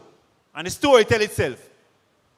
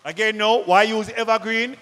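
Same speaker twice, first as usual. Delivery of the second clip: normal talking voice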